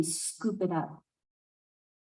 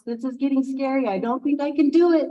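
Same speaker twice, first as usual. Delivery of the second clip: fast, louder panicky voice; rising fear; 3 semitones higher, 5 dB louder, faster than usual. Acted. Hawk near the fire losing nerve, panicked. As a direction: panic building near the heat. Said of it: "And he's like".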